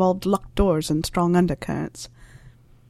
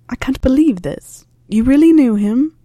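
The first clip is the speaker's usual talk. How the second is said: higher and louder in disbelief; rising shock, louder by the end; stunned disbelief; 6 semitones higher, 5 dB louder than usual. Acted. Harry learns Snape knew his father.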